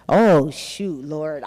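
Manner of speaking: low, sinking, dropping to near silence; dreading bad news. Answering the bank's call, dreading repossession, low and sinking. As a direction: mumble it like you're caught. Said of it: "I said".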